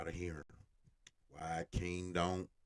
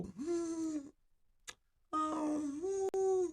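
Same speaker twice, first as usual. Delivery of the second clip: high whiny whimpering hums; mock whimper; much slower than usual.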